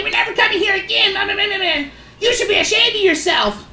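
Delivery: high, fast mock shrieking mom; mocking angry mom. The mom dragging her kid away and scolding him, high mock whine. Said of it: "what the heck is this lady's problem".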